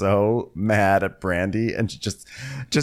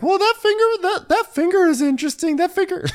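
very high mock squeal; squeaky, hammed-up needling; Brandy impression; 4 dB louder than usual. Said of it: "Brandy squeals. That's how she talks".

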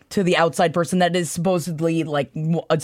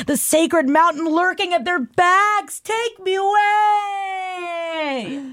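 reading that builds from plain to high, drawn-out wail; hammed-up romantic climax, ever higher and slower; swooning crescendo; 7 semitones higher, slower than usual. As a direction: climb steadily in pitch, stretching the ends into a melodramatic cry. Swooning over a romantic flight passage.

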